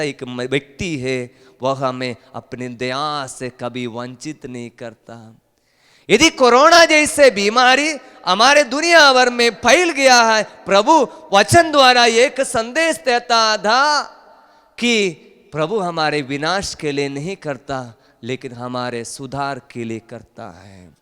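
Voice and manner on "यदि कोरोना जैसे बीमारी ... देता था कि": loud, high-pitched preaching cry; full-force preaching. Acted. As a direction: preach it out high and forceful, drawing words long. (ज़ोरदार, ऊँचे सुर में उपदेश)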